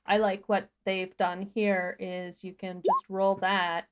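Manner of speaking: ordinary delivery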